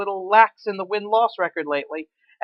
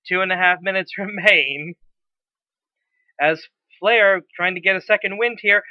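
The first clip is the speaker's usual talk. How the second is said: ordinary delivery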